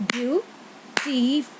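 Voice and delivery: "beautiful" slowly split into three beats; syllable by syllable. Shown as a demonstration. Teacher repeating for the count, "beautiful" slow and broken in three. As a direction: say "beautiful" slowly as beau, ti, ful. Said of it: "Three sounds are there".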